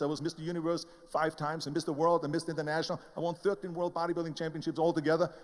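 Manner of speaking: usual speaking voice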